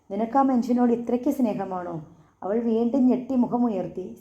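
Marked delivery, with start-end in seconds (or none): none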